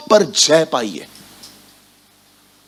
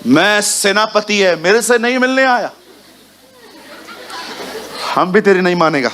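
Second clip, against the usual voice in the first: proud commander; 4 semitones higher than usual. Acted. Naaman insulted that Elisha did not come, haughty. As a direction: puff up like an offended general.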